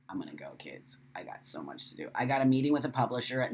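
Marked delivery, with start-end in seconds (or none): 0.1-2.1 much softer, lower, nearly breathed out; sighing softly. Real, not acted. Telling viewers she must go, softly sighing. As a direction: let it fall out on a sigh. Sighing about busy day.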